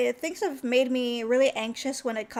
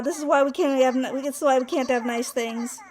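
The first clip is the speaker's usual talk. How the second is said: ordinary delivery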